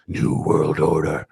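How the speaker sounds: low, slow and sinister; ominous voice. Shown as a demonstration. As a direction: say it like a creepy movie trailer. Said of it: "You have to say it like this".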